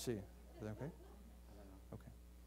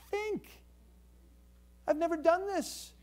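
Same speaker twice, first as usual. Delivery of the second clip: much higher pleading donkey voice; urgent donkey; 10 semitones higher, slower than usual. Acted. Donkey begging the prophet, high urgent plea. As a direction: plead with him to wake up. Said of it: "The donkey's saying to the guy".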